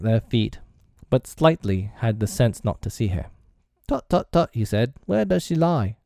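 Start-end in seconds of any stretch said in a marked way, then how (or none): 3.9-4.5 high, fussy tutting; pretend doctor. A narrator's reading. Slightly plays doctor. 5.1-6.0 put-on high, formal child's inquiry; pretend doctor. A narrator's reading. Slightly plays doctor.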